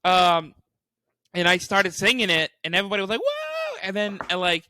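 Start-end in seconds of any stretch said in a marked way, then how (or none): 3.2-3.8 very high imitated whoop; mock crowd cheer. Everybody reacting as he starts singing, high mock cheer.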